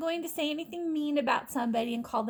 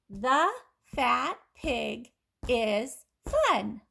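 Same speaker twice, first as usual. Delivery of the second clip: very slow, each word stretched; slow model sentence; much slower than usual. Shown as a demonstration. Teacher reads the sentence slowly to listening kids. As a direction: read it slowly so they can just listen. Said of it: "Just listen".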